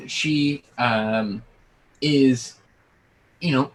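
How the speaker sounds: ordinary talk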